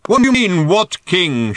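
high, loud indignant shout; exasperated high-pitched bellow. A narrator's reading. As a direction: yell it back over a din. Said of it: "shouted old Thrashbog".

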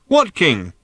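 high, loud question; short high shout. A narrator's reading. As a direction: yell it in bafflement. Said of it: "shouted Arthur".

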